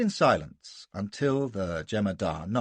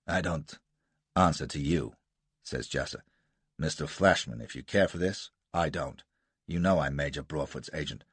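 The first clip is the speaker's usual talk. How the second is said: lower, cool defiance; Jasser, cold and low; 6 semitones lower than usual. Acted. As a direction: refuse him flatly.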